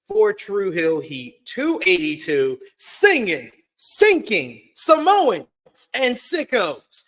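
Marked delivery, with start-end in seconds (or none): 2.8-7.0 building louder. Acted. Wordplay list building.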